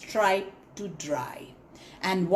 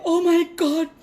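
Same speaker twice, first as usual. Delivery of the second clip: high, louder, slow frantic cry; panicked; 7 semitones higher, 4 dB louder, slower than usual. Acted. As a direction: call out desperately for the stripes. Zebra frantic over lost stripes.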